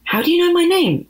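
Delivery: high, startled question; scared question. Acted. Nervous girl asking strangers how they know her. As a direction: ask it alarmed, voice jumping up.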